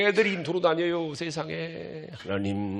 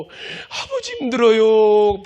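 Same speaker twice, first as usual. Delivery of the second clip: pleading cry; 7 semitones higher, 6 dB louder, slower than usual. Shown as a demonstration. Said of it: "이렇게 힘들다고요".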